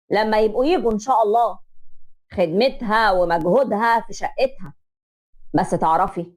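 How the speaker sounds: a father standing his ground, measured; stern father. Acted. Father confronting the mother-in-law, low and firm.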